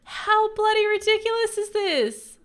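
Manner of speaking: high, loud, sustained yell of disbelief; outraged yell. Real, not acted. Outraged at the game.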